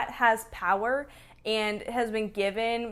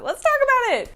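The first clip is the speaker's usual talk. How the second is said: jumps way up and louder; a big high-pitched encore of the line; squealed repeat; 14 semitones higher, 6 dB louder than usual. Shown as a demonstration. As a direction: squeal it high and loud, playfully. Repeats phrase, much higher.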